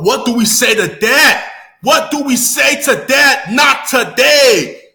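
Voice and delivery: loud call building to a drawn-out answer; rallying cry. Retold or imitated. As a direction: build up, then stretch the last words loud. Quoting the show's rallying line.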